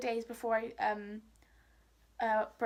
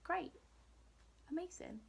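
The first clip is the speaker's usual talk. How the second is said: dry sarcasm, much softer and a bit higher; sarcastic thanks; 4 semitones higher, 16 dB quieter than usual. Retold or imitated. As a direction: say it flat-out sarcastic, under your breath. Sarcastic reply to school staff.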